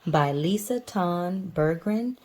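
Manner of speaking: ordinary delivery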